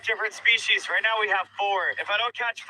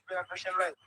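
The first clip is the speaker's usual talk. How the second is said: ordinary delivery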